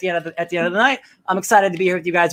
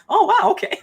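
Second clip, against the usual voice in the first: surprised. Retold or imitated. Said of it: "and you're like".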